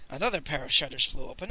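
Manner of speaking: ordinary delivery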